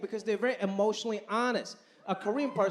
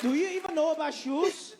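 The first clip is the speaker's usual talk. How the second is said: mom voice; 7 semitones higher than usual. Retold or imitated. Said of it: "She was like".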